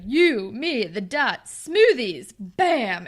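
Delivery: loud, punchy mock swagger; cocky pickup line. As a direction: bark it out like a clueless flirt. [name]'s clumsy pickup line.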